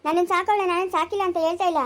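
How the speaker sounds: loud, indignant yell; a sister shouting back at her brother in the family fight. Acted. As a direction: hurl the words back at him.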